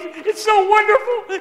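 high, loud, slow mock wail; comic imitation of joyful sobbing; imitated crying. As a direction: wail it like an overcome uncle, hammed up. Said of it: "And he starts crying".

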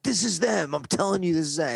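high, heated insistence; volatile cop. Retold or imitated. Hothead cop arguing with his partner, high heated insistence. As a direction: hammer it home like a hothead. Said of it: "this one guy is volatile".

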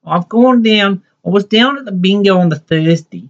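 imitating an aunt's Newtown accent. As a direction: chat about bingo in a thick Newtown accent. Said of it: "she talked with the Newtown accent".

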